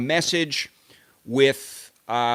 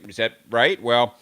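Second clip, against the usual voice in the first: normal talking voice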